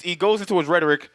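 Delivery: normal talking voice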